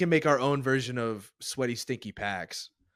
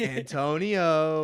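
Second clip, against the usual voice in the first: slow, high drawn-out name call; teasing, sung-out call; playful yelling; 5 semitones higher, 4 dB louder, much slower than usual. Pretend. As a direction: stretch the name into a long holler. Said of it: "Keep yelling".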